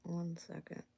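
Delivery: slow whispered aside. Real, not acted. Hunting for something off to the side, whispering. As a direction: whisper it to yourself, slowly. Searching quietly off mic.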